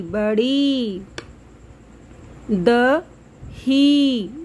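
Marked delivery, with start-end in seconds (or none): 2.5-4.3 दही drawn out slowly, the ई held long; slow stretched vowel. Shown as a demonstration. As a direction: read दही slowly for kids. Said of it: "बड़ी ई की मात्रा की आवाज़ थोड़ी सी लंबी". (दही को धीरे खींचकर)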